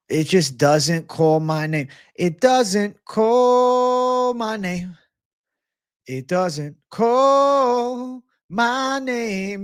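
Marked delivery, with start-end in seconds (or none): 2.2-9.6 high, slow, drawn-out Jamaican accent. Shown as a demonstration. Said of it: "Gotta do the Jamaican accent".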